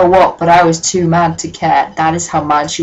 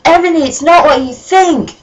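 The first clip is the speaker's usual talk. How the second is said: much higher, hammed-up desperate plea; pleading cry; 9 semitones higher than usual. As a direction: overact a desperate lover. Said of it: "Draco screamed sadly".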